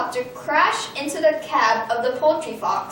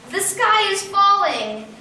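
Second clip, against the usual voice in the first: chorused alarm; 6 semitones higher than usual. A narrator's reading. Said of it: "chorused the birds".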